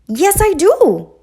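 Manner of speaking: high, loud protest; crying out. A narrator's reading. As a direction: shout back at Duck defensively. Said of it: "Goose cried out".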